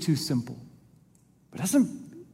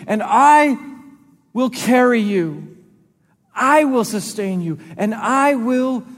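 rising, louder scripture read as God's voice; preaching at full force; 4 semitones higher, 4 dB louder than usual. Acted. Preacher to church, proclaiming God's promise in Isaiah. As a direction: proclaim it, building with each promise.

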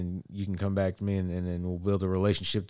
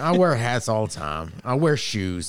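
mounting mock-indignant excuses, high and loud; playful protest; 5 semitones higher, 5 dB louder than usual. Real, not acted. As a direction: plead your case with rising exasperation. Protests the measurement amid laughter.

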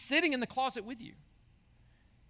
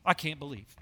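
softer, lower, mock disappointed; a hushed, lightly acted tut-tut; mock shocked God; 9 semitones lower, 6 dB quieter than usual. Preacher imitates a false God judging sin, lower and softer. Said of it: "look at your sin and go".

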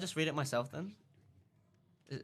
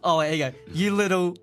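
angry shout; 4 semitones higher, 12 dB louder than usual. Acted. Voicing angry Harry in story.

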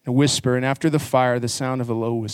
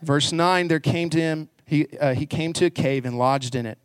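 ordinary delivery

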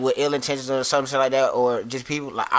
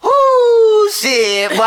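relieved whoop; 9 dB louder, much slower than usual. Real, not acted. Relief after near catch.

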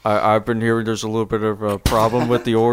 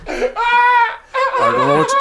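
put-on child voice. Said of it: "Why do you sound like a kid trying to scare the mailman away?".

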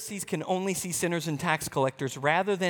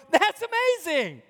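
much higher, bright delight; overjoyed brother rejoicing; joyful news; 10 semitones higher, 4 dB louder than usual. Pretend. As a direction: gush with happiness at the return. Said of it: "hear the news and go".

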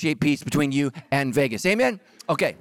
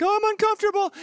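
much higher, whiny mock voice; mock uncomfortable congregation; faster than usual. Pastor mocks his congregation's discomfort in a high, whiny voice. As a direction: squeak it out like a squirming churchgoer. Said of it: "everybody's like".

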